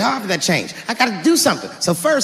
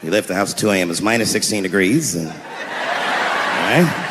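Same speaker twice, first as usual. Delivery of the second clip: much lower, tired cop voice; weary police veteran; 10 semitones lower, 3 dB quieter than usual. Acted. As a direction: sigh through the report like a jaded officer. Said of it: "a police veteran taking this kid's police report".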